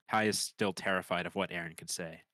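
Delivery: normal talking voice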